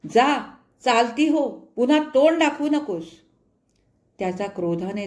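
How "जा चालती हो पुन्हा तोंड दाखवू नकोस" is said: slow, loud and higher angry shout; husband ordering wife out, furious shout. A narrator's reading. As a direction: throw her out with a drawn-out yell. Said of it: "त्याने ओरडून म्हटलं".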